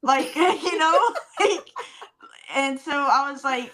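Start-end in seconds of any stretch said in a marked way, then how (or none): 0.0-1.7 laughing. Real, not acted. Laughing at awkward memory.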